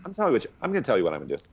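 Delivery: fast, tumbling words; rushed. Real, not acted. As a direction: rattle it off quickly. Excited rant.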